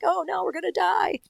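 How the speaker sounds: high, mildly hammed-up panic; panicked game character. Video game extra facing death, mock panic. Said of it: "you're just like".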